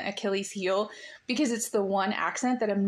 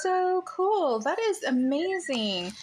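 higher-pitched, gushing praise; delighted admiration; 7 semitones higher, slower than usual. Real, not acted. Host gushing over the guest's writing workshops, delighted admiration. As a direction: react with real, impressed delight.

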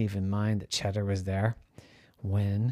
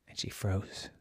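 whisper; 3 semitones lower, 10 dB quieter than usual. A narrator's reading. Suspense whisper narrating.

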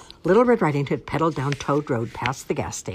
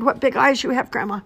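high, strained storybook wolf; frightened wolf; 11 semitones higher, 6 dB louder than usual. A narrator's reading. Wolf facing Grandma in her grip, frightened. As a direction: quail in a raised voice. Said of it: "he finally whispered".

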